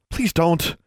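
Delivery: high, hasty plea; hurried high-voiced begging; quickly. A narrator's reading. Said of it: "Alexandra said quickly".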